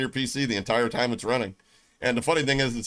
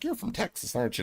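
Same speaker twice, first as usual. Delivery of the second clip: a hint of a high nosy voice; lightly voiced curious stranger; mock questioner; 9 semitones higher, 6 dB quieter than usual. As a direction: tease the locals asking.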